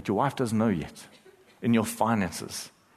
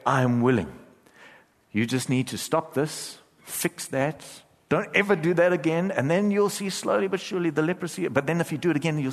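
higher, mocking voice of a demanding God; mock conditional Jesus; 7 semitones higher than usual. Shown as a demonstration. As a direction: sneer at the strings-attached version, pitched up. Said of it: "He didn't say".

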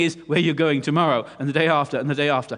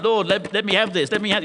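raised, urgent demanding prayer; acted insistence, pushing higher; insistent demand; 4 semitones higher, 4 dB louder than usual. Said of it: "you're insisting".

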